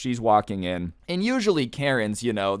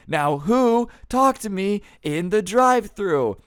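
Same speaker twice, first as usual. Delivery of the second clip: high, slow, drawn-out challenge; slow mock-yell, pitched way up; furious demand; 9 semitones higher, 4 dB louder, slower than usual. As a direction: imitate her calling out the culprit. Acted customer demanding the culprit again.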